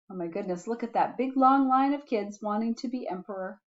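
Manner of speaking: plain reading voice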